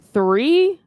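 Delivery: three punched high and loud; stress on three. Real, not acted. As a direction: hit the word three hard. Stressing the statistic.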